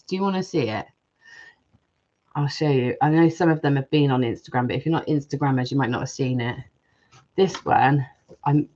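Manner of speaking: normal talking voice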